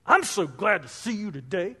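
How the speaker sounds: same words with a sour, insincere twist; sarcastic tone. Shown as a demonstration. Preacher contrasting a kind greeting with a snide one. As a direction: make the greeting sound fake and grudging. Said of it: "you notice it's totally different".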